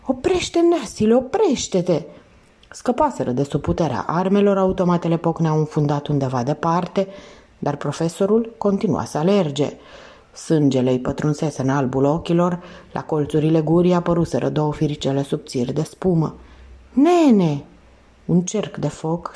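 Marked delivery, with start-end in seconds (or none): 0.1-2.0 high-pitched, alarmed cry; frightened thief's high plea; horrified plea. A narrator's reading. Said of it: "Hoțul îl zgâlțâia îngrozit". 16.9-17.6 loud, high call; raised, urgent shout. A narrator's reading. Thief calls to the delirious runner.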